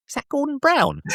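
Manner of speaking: high, awed mock wife voice; wife suddenly impressed by the caller, voiced high. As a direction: gasp it out, pitched high, impressed.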